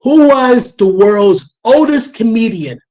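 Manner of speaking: loud, slow, deliberate question; measured, projected voice query; dictating to AI. Real, not acted. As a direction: speak up clearly for a voice assistant.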